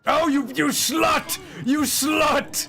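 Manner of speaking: real frustrated gamer shout; angry shout. Real, not acted. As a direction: yell your outrage at the screen. Enemy outplays him in game.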